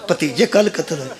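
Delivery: normal talking voice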